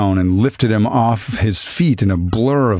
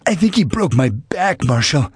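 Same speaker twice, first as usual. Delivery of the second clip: higher, strained and winded; hoarse; 6 semitones higher than usual. A narrator's reading. Downed robber complaining to the marshal, hoarse and winded. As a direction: squeeze it out with no air left. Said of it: "said hoarsely".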